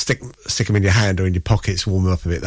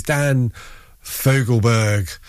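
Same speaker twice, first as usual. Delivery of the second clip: relishing the name; much slower than usual. Real, not acted. DJ savouring a favourite name, [name] said slowly with relish. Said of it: "I like saying this guy's name".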